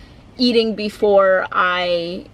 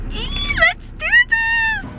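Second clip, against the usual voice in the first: high, drawn-out squeal of excitement; excited squeal; much slower than usual. Real, not acted. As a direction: squeal it high, stretching the words. Hyping child up for gymnastics.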